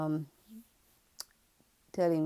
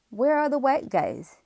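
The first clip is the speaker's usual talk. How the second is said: imitating a rude customer, much higher and louder; blunt customer; 8 semitones higher, 8 dB louder than usual. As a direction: throw out the rude man's question loud and high. Customer's blunt question retold.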